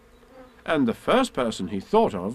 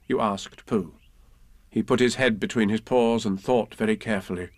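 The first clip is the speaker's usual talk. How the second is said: ordinary delivery, nothing marked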